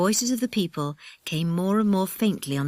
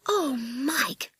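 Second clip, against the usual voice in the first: put-on high, drawn-out girlish exclamation; startled girl; 8 semitones higher than usual. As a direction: exclaim it, shaken and relieved. Girl recovering from a fright.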